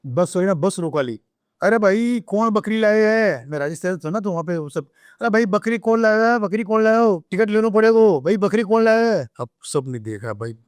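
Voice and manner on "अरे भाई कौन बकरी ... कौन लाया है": conductor calling out. Retold or imitated. Imitating bus conductor calling out.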